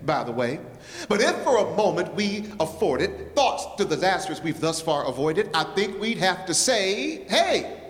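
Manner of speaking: rhythmic, rhyming poem performance; lively spoken-word recital for the congregation. Acted. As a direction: lean into the rhymes like a slam poet.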